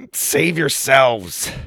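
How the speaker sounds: slow, drawn-out heroic plea; strained order. A narrator's reading. Trapped Wonka sending the kids away, slow strained order. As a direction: labour out a dramatic order. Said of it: "he ordered".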